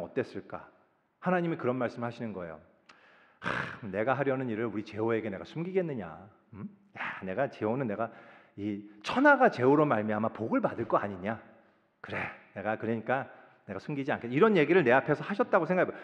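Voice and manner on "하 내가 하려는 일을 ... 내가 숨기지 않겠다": low, soft musing to oneself; God's monologue. Acted. God deliberating whether to hide his plan, low musing soliloquy. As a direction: lightly act God thinking aloud, lowered voice. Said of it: "그런 말씀을 독백을 하시는 걸".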